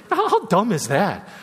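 tickled, self-mocking exclamation; amused disbelief. Real, not acted. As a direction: chuckle at your own absurdity. Laughing at his own absurdity.

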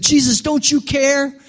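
high-pitched, imitated panicked plea; freaking-out disciple. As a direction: act out an alarmed appeal, pitched right up. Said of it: "the disciples are freaking out".

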